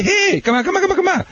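very high, sing-song coaxing with a laugh; coaxing call to a dog. Retold or imitated. Owner kneeling and calling the dog in, very high and coaxing. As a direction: lure the dog in with a high, eager voice. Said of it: "Just pull, say".

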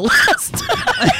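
reading through real laughter; cracking up mid-sentence; laughing while reading. Real, not acted. Reading a funny comment aloud.

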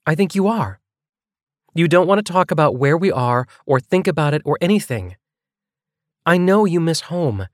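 earnest, pressing female character voice; Nevaeh, insistent. Acted. Nevaeh confronts Cole in argument.

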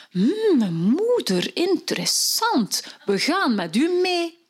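high, slow, intrigued voices; fairy-tale figures. Acted. Fairy-tale figures join the girl, high and intrigued. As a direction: muse slowly, then lift up high. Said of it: "En allemaal zeggen ze".

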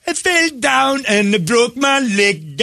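high, slow mock Jamaican accent. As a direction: sing it out in a hammed-up Jamaican accent. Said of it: "I like the Jamaican accent".

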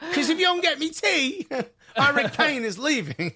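very high mock English whine; English accent. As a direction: threaten it like a spoiled star. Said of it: "He talks in third person".

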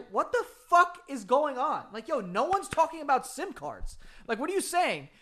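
animated mock disbelief; exasperated bafflement. Friends baffled by the sim card rant, retold exasperation.

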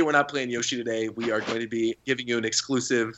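usual speaking voice

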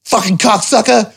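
high, hammed-up furious rant; retold fury, pitched high. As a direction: vent at the boss who stopped you. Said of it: "So I was like".

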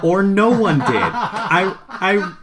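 real laugh breaking through the words; laughing at his own punchline. Real, not acted.

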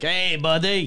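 mock French Canadian greeting; French Canadian accent. Salesman greeting a French Canadian, mock French Canadian accent. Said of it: "like a French Canadian, you'd be like".

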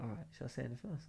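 quick hushed question. Retold or imitated. Whispering at the bar.

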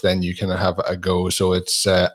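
ordinary delivery

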